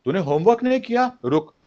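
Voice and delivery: high, rapid-fire angry demand; acted parent losing control; unhealthy anger. Shown as a demonstration. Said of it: "Unhealthy anger क्या है?".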